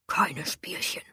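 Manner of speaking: menacing hoarse whisper; low threatening warning. Acted. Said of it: "warnte mich mein Peiniger".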